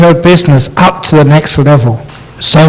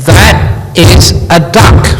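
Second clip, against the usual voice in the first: stubborn husband; 3 semitones higher, slower than usual. Acted. Husband insists in quarrel.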